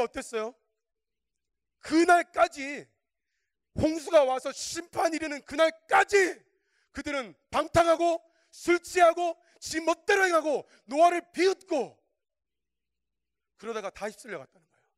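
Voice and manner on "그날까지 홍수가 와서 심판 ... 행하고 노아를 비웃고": high, loud, stretched-out proclamation; thundering preaching. Real, not acted. Preacher warning the congregation of the flood judgment, thundering. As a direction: drag out each phrase like a warning cry.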